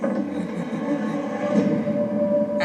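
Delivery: loud burst of laughter; laughing out loud. Real, not acted. Reacting to the show clip.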